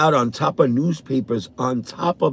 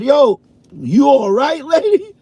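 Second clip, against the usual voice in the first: startled high call; 12 semitones higher, 4 dB louder than usual. Retold or imitated. Finding the neighbor crouched by the shed, startled high call. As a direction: call out in alarm, pitched way up.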